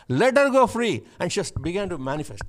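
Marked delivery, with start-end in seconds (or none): 0.1-1.0 high, loud command to Pharaoh; a retold rebuke of a demon, cried out; commanding shout. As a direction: order him out in a booming shout. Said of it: "I said".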